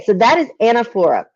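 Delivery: ordinary talk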